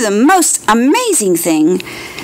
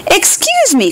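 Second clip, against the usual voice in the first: little cricket voice; 11 semitones higher than usual. Acted. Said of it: "coughed a cricket".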